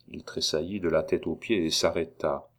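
usual speaking voice

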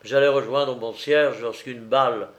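usual speaking voice

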